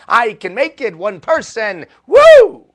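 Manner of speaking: loud, high hype building to a whoop; fired-up excitement. Retold or imitated. Speaker relives being inspired by the quote, loudly fired up. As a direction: get pumped up and cap it with a high whoop.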